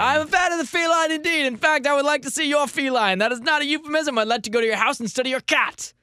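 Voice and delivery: high, put-on aristocratic fop; pompous suitor character. Gentleman courting by way of cats, foppish character voice. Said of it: "that's my new character".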